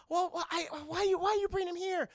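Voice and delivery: flustered. Retold or imitated.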